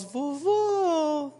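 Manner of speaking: put-on high sugary little-kid call; sweet child voice. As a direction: coo like a kid buttering up grandpa. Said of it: "o [name] muda até a voz".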